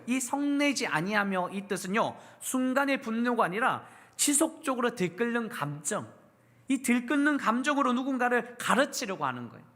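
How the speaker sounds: usual speaking voice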